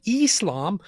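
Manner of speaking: "Islam" with a high, stretched "ee" at the start; mock the vowel, "Islam" as a loud "EES-lahm"; mispronounced I. Co-host mocking Lily J's vowel, "Islam" with exaggerated "ee". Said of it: "the pronunciation of the I".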